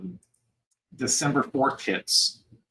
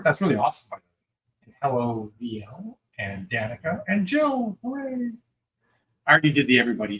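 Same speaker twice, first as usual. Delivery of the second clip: usual speaking voice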